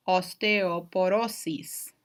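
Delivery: osteoporosis slowly in Spanish, os-teh-oh-po-RO-sis, every vowel pure; drawn out with a tapped r: os-te-o-po-ro-sis; Spanish pronunciation. Shown as a demonstration. Teacher showing students how Spanish says it, slow Spanish pronunciation. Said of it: "you say".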